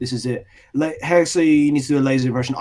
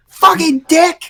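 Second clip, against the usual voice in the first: high, slow mock shouted swear; 12 semitones higher, 6 dB louder, much slower than usual. Imitating the edited swear clip for friends, a high slow mock shout. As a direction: ham up a cartoon swear clip.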